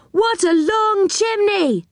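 high, drawn-out call down to those below; calling back. A narrator's reading. Joe calls back to those climbing below, calling out. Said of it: "called back Joe".

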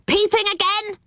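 high-pitched outraged squawk, no real volume; an indignant pixie catching a snooper; angry shout. A narrator's reading. Angry pixie catches Rick at his window, high indignant shout. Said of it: "he shouted".

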